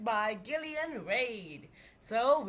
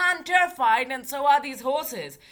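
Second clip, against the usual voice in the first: loud, higher panicked voice; terrified shout; 4 semitones higher, 8 dB louder than usual. Acted. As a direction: yell in panic. Terrified driver.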